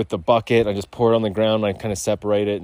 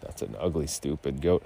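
low, quiet scoffing; quiet dismissive put-down; mock scorn; 7 semitones lower, 11 dB quieter than usual. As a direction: sneer at the goat under your breath. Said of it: "And I was like".

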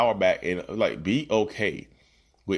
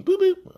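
very high, louder beeping; Zoom chime imitation; 7 dB louder than usual. Copying the Zoom alert sound, high beeps. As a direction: imitate the notification pop. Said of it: "I hear the Zoom pop up".

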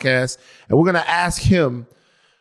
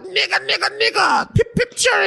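put-on very high, squealed jolly-old-England accent; mock English crowd. English fans chanting along at a rap show, high mock British. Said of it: "they're in jolly old England. They're like".

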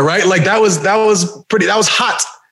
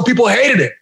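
usual speaking voice

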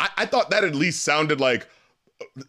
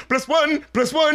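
high squeaky chipmunk; 9 semitones higher than usual. Retold or imitated. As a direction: sing it like a sped-up sample. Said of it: "chipmunk soul sample".

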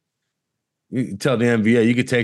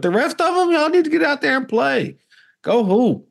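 calling out; 12 semitones higher than usual. Acted. Calling players out.

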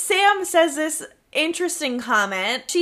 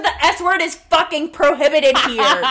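mock furious; 3 semitones higher, 4 dB louder than usual. Host riffing on the diabetic character, in a laughing mock scold. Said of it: "She said".

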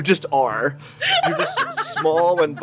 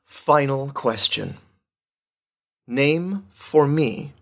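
quiz host; slower than usual. Acted. Quiz host reading final question.

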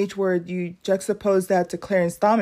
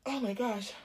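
choking on water; 2 semitones higher, 10 dB quieter than usual. Real, not acted. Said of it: "I've been drinking cold water".

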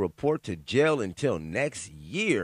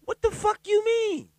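outraged disbelief. Real, not acted. Outraged at lenient sentence.

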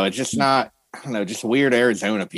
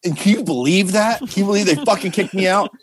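higher-pitched mock outrage; retold indignant rant; 6 semitones higher than usual. Fired drummer venting about being kicked out, mock indignant. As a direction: imitate an incredulous, aggrieved complainer.

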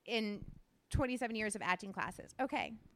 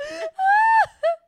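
high, loud cackling shriek; shrieking laugh; 18 dB louder than usual. Real, not acted. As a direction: burst out in a screaming laugh. Cracking up after the reading.

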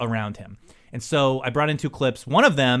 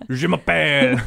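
mock French, louder; French accent; 5 dB louder than usual. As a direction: play a cartoon Frenchman. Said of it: "your French listenership".

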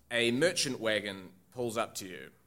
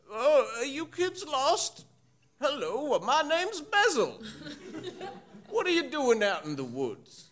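put-on surprised old groundskeeper; 12 semitones higher than usual. Groundskeeper finds kids in the woods, high surprised voice. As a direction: play a startled, friendly old groundskeeper. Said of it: "shock, su-, surprise".

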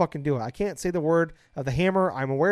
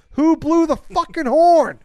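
full-throated yell, much higher and louder; real yelling; 10 semitones higher, 10 dB louder, slower than usual. Real, not acted. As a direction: shout it out in real frustration. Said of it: "I'm yelling".

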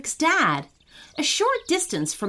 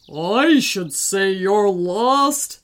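slow booming giant; big slow giant voice; 4 dB louder, much slower than usual. A narrator's reading.

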